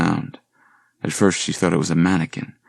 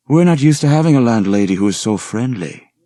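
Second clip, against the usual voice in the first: suggestive; 6 semitones higher, 6 dB louder than usual. Acted. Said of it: "Liam told her suggestively".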